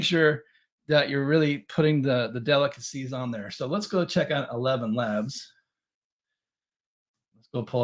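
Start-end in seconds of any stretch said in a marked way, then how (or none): none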